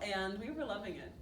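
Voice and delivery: usual speaking voice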